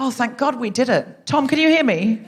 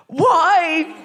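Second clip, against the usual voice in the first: high, put-on outraged "why"; indignant protest; 7 semitones higher, 3 dB louder than usual. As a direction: protest like you've been told you must. Acts out indignant reply to being ordered.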